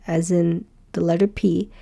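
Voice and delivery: ordinary delivery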